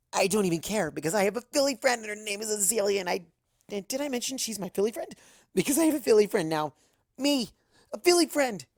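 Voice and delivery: excited. Acted. Giddy rambling over friend.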